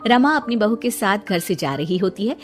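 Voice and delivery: ordinary delivery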